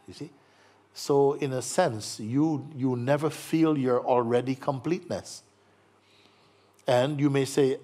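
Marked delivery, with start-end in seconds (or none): none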